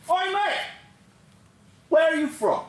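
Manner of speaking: put-on high, loud British accent; British lad. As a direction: call out the question like a British lad. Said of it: "back to the British boys".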